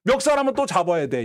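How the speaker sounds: ordinary delivery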